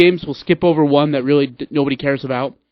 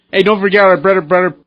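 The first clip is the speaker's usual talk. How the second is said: announcer voice; 3 semitones higher, 5 dB louder than usual. Acted. Promo for the bread and butter play.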